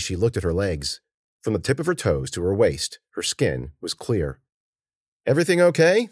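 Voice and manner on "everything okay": higher, lightly projected question; calling out. A narrator's reading. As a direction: call over to check on someone. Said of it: "the man called".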